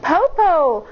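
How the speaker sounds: high call down the tree; shouting. A narrator's reading. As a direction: call out to check on him. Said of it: "Pout shouted".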